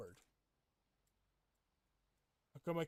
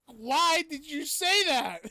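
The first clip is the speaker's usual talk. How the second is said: slow, stretched, much higher and louder lament; dramatic mock wail; 12 semitones higher, 17 dB louder, much slower than usual. Streamer wailing at a viewer's remark, hammed-up. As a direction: drag every word out like a wounded diva.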